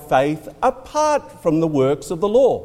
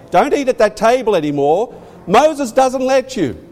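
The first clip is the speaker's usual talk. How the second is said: higher, insistent telling-off; scolding prohibition; 6 semitones higher than usual. Retold or imitated. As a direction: lay down the law, climbing on the second part.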